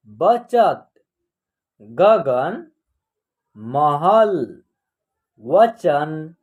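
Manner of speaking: deliberate, isolated model word; word-list reading. Shown as a demonstration. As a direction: read it out for learners to repeat.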